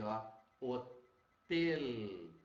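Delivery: "hotel" said very slowly, the final L held and clearly pronounced; a teacher modelling the word slowly for the class; drawn-out demo word. Shown as a demonstration. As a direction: stretch out "hotel" so the final L rings. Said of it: "agora olha o L".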